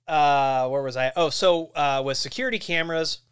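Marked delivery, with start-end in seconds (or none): none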